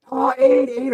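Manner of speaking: put-on high, loud excited kid calling out; a high-pitched excited uni student; excited American kid. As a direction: squeal his name like an eager student. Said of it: "one of the kids comes running out".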